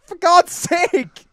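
high, drawn-out exasperation; slow, high cry of frustration; exasperated. Real, not acted. Exasperated by the unclear name.

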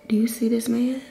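hushed whisper. Real, not acted. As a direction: whisper it secretly. Whispering about man in background.

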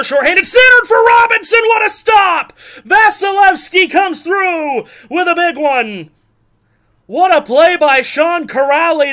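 high, loud play-by-play shout; excited shout. Real, not acted. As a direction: yell the chance as it happens. Live scoring chance call.

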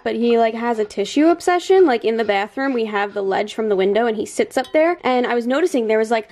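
ordinary talk, nothing marked

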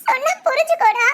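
high, tearful begging; tearful pleading. Acted. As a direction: plead with him through tears. Girl pleading, crying under threat. (அழுகையுடன் கெஞ்சுதல்)

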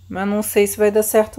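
ordinary delivery

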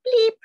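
high, loud squawking bleep; ostrich cry. Acted. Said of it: "cried the ostrich".

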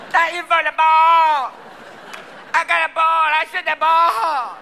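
loud, high, drawn-out player's holler; exuberant shout. Acted. Soccer player given the ball, exuberant shout. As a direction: bellow it with stretched-out endings.

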